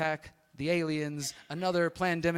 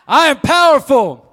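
much louder, slower, higher; booming motivational speech; 10 semitones higher, 21 dB louder, slower than usual. Real, not acted. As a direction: belt it out even bigger. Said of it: "not good enough".

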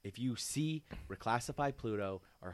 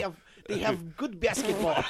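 put-on high, laughing stab at Russian accent; Russian accent that breaks into laughter; 8 semitones higher, 6 dB louder than usual. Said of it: "I can't do a Russian accent".

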